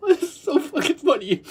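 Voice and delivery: high, breaking with amusement; laughing fit. Real, not acted. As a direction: squeal it at the tail of a laugh.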